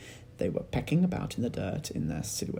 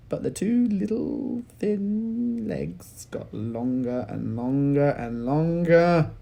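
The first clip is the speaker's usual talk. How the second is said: slower and slower, stretching; drawn-out growing; 5 semitones higher, 7 dB louder, slower than usual. A narrator's reading. Narrator stretches the words as the legs grow. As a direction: stretch each longer out.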